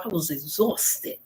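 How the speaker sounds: light New York accent, slow and drawn; a hint of a New York drawl. Shown as a demonstration. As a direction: say it like a New Yorker, unhurried. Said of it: "like my brothers and sisters from New York".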